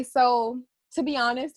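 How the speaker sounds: ordinary talk, nothing marked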